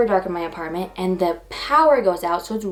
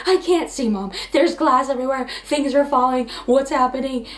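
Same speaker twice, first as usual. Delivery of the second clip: panicky; 5 semitones higher than usual. Retold or imitated. Retold panic on phone with mom.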